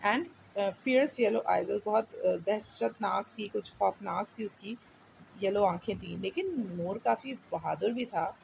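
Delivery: normal talking voice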